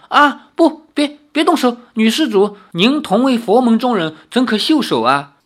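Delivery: 高声慌乱地求救; 惊慌失措地喊; 慌忙求饶. Acted. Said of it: "连忙说". (惊慌地连声喊)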